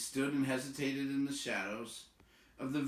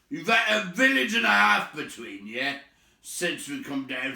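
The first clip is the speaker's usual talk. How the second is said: high, loud troll scolding; indignant troll; 6 semitones higher, 11 dB louder than usual. Acted. William scolding Bert and Tom for their appetite. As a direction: William lecturing his mates.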